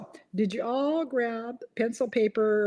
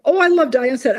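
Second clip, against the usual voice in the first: ordinary delivery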